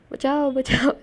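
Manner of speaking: half-hearted imitated yell; mock cry for help. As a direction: act out a call for help at ordinary volume. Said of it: "चिल्लाने ही वाला था".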